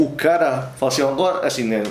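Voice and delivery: normal talking voice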